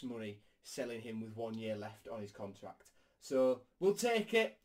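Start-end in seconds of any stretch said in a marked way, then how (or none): 3.2-4.6 excited. Real, not acted. Excited wrap-up cheer.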